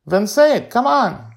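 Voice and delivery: put-on high, loud child's dare; goading young Joy. A narrator's reading. Girl dares the robot to curse, high and goading. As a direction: egg him on loudly like a kid.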